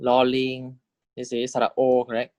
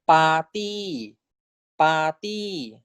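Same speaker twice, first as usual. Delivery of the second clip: slow careful model; 4 semitones higher, much slower than usual. Shown as a demonstration. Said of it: "So this is party".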